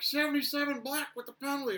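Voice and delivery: high, loud mock referee shout; imitated referee call. Short refs on tippy toes calling penalties to the box, imitated shout. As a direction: shout it like a ref calling over the glass. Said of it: "and they're like".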